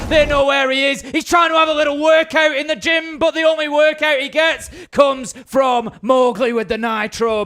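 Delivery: excited play-by-play. Real, not acted. Caster calling the winning play to viewers, loud and thrilled. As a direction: ride the hype of the round.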